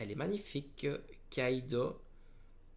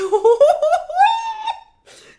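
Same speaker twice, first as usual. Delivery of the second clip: very high, loud squealing laugh; real hysterical squeal of delight; ecstatic shriek; 21 dB louder than usual. Real, not acted. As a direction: scream with joy, half laughing. Overjoyed at the god pack.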